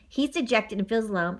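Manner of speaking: ordinary delivery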